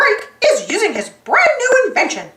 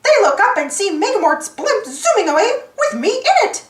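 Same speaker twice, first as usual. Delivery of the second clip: plain reading voice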